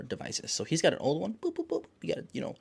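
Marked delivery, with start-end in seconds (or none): 1.4-1.8 high, soft boops; little high-pitched phone beeps; imitated keypad beeps. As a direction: mimic an old phone's buttons in a high voice.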